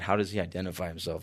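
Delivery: fast, low, quick mumble of the wrong line; tossed off as a quick mock slip; rushed slip. Shown as a demonstration. Preacher mocks his own near slip, fast and low. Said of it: "I almost said".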